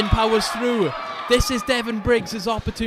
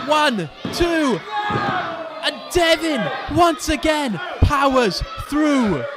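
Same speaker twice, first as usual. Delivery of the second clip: wrestling commentator; 6 semitones higher than usual. Acted. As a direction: call every move like the crowd is roaring. Fired-up live wrestling play-by-play.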